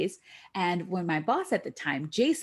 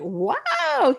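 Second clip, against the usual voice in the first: high, louder astonished wow; amazed wow; 11 semitones higher, 6 dB louder than usual. Retold or imitated. Hearing teammate leaves early, high amazed wow. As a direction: let the wow leap up.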